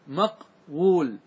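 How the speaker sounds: مقول stretched syllable by syllable, very slowly; very slow drawn out form. Shown as a demonstration. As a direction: spell مقول out slowly with the waw held. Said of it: "أصله إيش؟". (نطق مقول ببطء شديد مع مد الواو)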